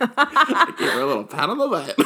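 high, loud, about to crack up; real giggly embarrassment; laughing confession. Real, not acted. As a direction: confess it while bursting into laughter. Confesses while breaking into laughter.

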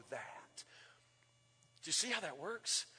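quiet aside; whisper. Real, not acted. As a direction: drop to a hushed whisper. Sermon aside dropped to whisper.

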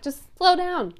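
loud, high-pitched mock pleading; playfully frustrated, pitched well above normal; exasperated plea. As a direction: beg a rushing class, voice jumping up. Acted plea to rushing class members.

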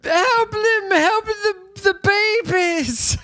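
mock panic. Podcaster acting out panicked crowd running to shore, mock panic. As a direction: act out hammed-up panic, stretching the last words.

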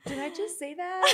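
amused self-scolding; laughing mock shock. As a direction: act shocked at yourself while laughing. Mock shock after blurting.

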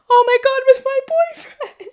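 high, loud squeal with laughter; weird giddy squeal. Real, not acted. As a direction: squeal it out, drawing out the last word. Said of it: "make a fucking weird ass sound".

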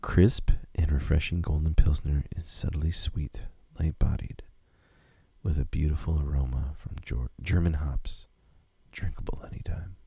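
whispered beer ad read; commercial voice. Acted. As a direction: breathe the ad copy in a whisper. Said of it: "you got the commercial voice".

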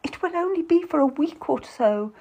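high-pitched coaxing appeal; pleading. A narrator's reading. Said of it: "Lucy pleads".

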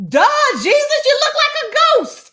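sarcastic high retort. Acted. Disciples answering Jesus's question, high and sarcastic. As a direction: roll your eyes at the obvious.

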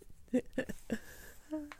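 breathy high giggle; soft giggle. Real, not acted. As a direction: giggle at the joke. Chicken in a jumpsuit.

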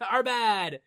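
loud hammed-up brush-off; mock staff scolding. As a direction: wave him off like a sheepish nurse. Mock hospital staff scolding Jack.

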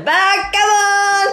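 high, loud mock bellow of an old cop; hammed-up cartoon scolding shout; angry old policeman yell. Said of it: "駐在さんみたいに怒られて".

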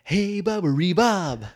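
loud, high, drawn-out call; playful hollered name-calling; mock calling out. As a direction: holler it out in a goofy character voice. Acts character calling her name.

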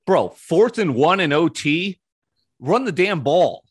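loud, high, drawn-out exasperation; exasperated rant. Real, not acted. Fan rants at coach's call on fourth and one, exasperated.

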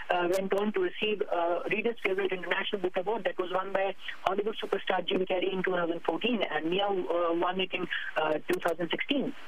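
speaking with a Pakistani accent; natural Pakistani-accented English. Real, not acted. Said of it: "you have a very heavy Pakistani accent".

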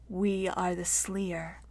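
hushed, slow ancient creature; very soft, drawn-out eerie whisper-like voice; old, dry voice. A narrator's reading. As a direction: scrape it out softly, like a dry twig. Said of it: "something very old and very dry, like the scraping of a dead twig".

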